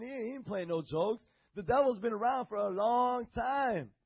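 normal talking voice

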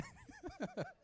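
high, quiet giggling fit; helpless laughter. Real, not acted. Preacher losing it over the word thingy, high giggles. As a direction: crack up at your own slip. Cracks up at own slip.

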